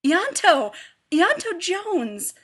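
loud, high, drawn-out call across the room. A narrator's reading. Woolsey calling out to an old friend, loud and delighted. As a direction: hail him from a distance, delighted.

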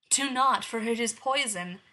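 loud, urgent warning; urgent argument. Acted. Huntress warning the princess off the apple, loud urgent warning. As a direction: stop her before she bites. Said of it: "the huntress argued".

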